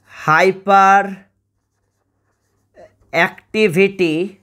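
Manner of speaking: each word slowly, with long pauses between; slow dictation. Shown as a demonstration. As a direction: spell it out word by word for note-taking. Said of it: "পুরো নামটা একটু ভালো করে মনে রাখবে".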